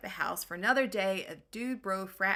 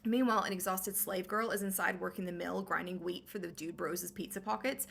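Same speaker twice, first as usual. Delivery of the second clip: ordinary talk, nothing marked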